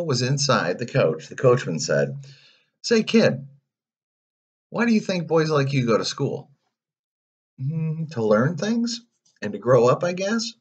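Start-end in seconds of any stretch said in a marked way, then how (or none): 2.8-6.5 high, chummy pitch; pushy coachman sweet-talking a boy; coachman hustler. A narrator's reading. 7.6-10.5 put-on high, tentative child voice; unsure boy. A narrator's reading. Pinocchio answering, unsure.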